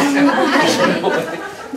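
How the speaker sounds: carcajada fuerte y alta; ríete de tu propia broma; loud laugh. Real, not acted. El predicador se ríe. (carcajada del predicador ante su broma)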